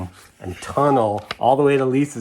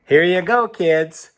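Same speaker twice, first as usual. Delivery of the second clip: cheery parent voice; 7 semitones higher, 6 dB louder, slower than usual. Acted. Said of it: "they're saying".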